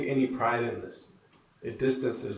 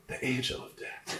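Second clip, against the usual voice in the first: hushed, breath-only sickbed reply; softly; 10 dB quieter than usual. Acted. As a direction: whisper it weakly as the patient. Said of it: "he says softly".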